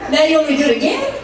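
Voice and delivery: ordinary delivery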